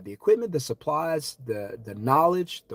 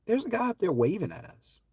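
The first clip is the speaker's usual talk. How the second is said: oblivious beachgoer; 9 semitones higher than usual. Retold or imitated. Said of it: "you say".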